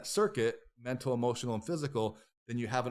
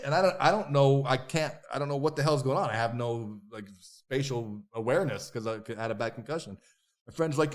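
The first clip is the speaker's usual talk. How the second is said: ordinary delivery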